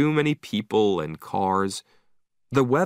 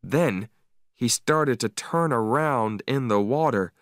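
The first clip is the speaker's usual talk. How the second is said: ordinary delivery, nothing marked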